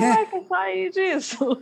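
high-pitched, amused amazement; mock astonishment at your own escape; surprised realization. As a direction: marvel at having got out, voice up high.

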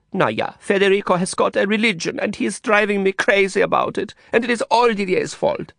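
put-on a sulky young woman with a foreign accent; Ulla's foreign-accented complaint. A narrator's reading.

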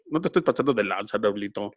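a hint of a Yucatecan buddy teasing; Yucatán friend's voice. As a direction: mimic the friend from Mérida. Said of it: "¿Cómo hablaba así?".

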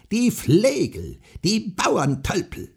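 very high, loud, slow goblin scream; shrieking goblin. A narrator's reading. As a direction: screech the insults like a goblin. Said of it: "schrie er".

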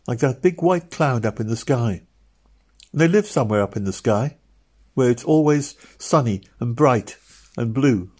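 put-on a small girl describing angels with awe; little girl Meg.

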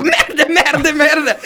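high-pitched hammed-up French cursing; mock yelling. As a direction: imitate a stressed driver yelling at traffic. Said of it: "Zat hij weer te schreeuwen".

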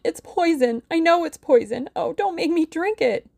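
high-pitched frantic acted moan; moaning plea. A narrator's reading. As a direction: plead frantically, high and wailing. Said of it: "she moaned".

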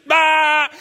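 put-on loud, high angry toddler cry; toddler's wordless shout. Son fighting his sister, loud wordless angry yell. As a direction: shout it like a child with no words. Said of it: "doesn't really know how to talk".

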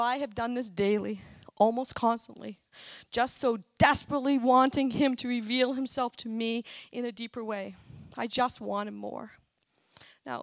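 ordinary delivery

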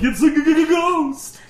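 high stammering spooky fright; scary voice. Pretend. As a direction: stutter out ghost in mock terror. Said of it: "my scary voice that I'm reading it in".

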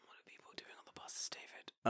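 breathless shocked whisper. Real, not acted. Asking David about bus stories, shocked whisper. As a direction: whisper it, scandalised. Shocked hushed question to friend.

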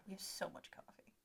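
hushed aside whisper. Real, not acted. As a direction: whisper it like a secret. Joking aside about their coffee.

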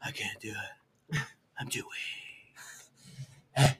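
hammed-up fading strength, barely voiced; feeble mock weakness. [name] gives up on the task, feeble mock-weak voice.

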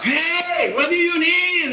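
high, drawn-out hollered welcome; hearty call-out. Retold or imitated. Whole family coming out of the shop, calling out. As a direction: call out a big high-pitched hello.

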